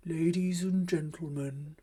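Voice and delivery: lightly voiced old clown, formal and subdued; a hint of the aging clown announcing to the crowd; old clown addressing audience. A narrator's reading. Old clown telling the circus audience, lightly voiced and solemn. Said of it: "he said".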